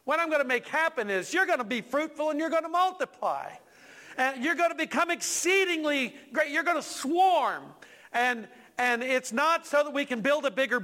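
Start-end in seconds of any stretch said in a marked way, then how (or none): 0.0-7.7 high, ringing declaration; God's emphatic promise. Retold or imitated. Preacher voicing God's promise of fruitfulness, emphatic. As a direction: proclaim it as God, pitched well up. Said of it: "But God reminds me".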